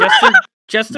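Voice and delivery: high, loud laughing shout of a name; laughing call. Real, not acted. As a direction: call him out mid-laugh. Laughing, calling on friend.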